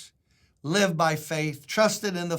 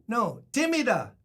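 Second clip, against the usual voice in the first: calling out into the mic; yelled; 7 semitones higher, 3 dB louder than usual. Retold or imitated. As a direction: shout the word out. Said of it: "I yelled in the microphone".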